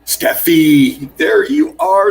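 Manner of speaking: high, loud call-out to a friend who just arrived; light up as she appears; delighted welcome; excited greeting. Real, not acted. Greeting a viewer joining the stream.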